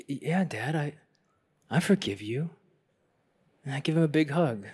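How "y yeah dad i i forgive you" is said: shaken. Retold or imitated. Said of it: "I'm shaken".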